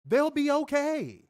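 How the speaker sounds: mock soothing; sarcastic. Real, not acted. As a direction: drawl it mockingly. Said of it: "sarcastic reassurance".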